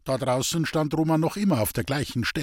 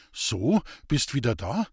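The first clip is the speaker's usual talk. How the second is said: usual speaking voice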